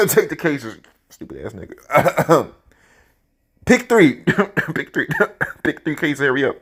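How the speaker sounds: cracking up while hurrying him; laughing urgent. Retold or imitated. Urging Fred, laughing.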